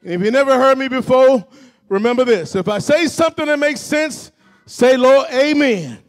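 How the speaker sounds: rally the church to talk back; rousing preacher's projection; preaching. Real, not acted. Preaching at full force.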